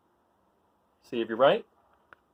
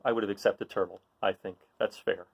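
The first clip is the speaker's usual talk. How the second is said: ordinary delivery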